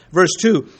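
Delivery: ordinary talk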